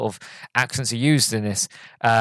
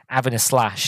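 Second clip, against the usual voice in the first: ordinary talk, nothing marked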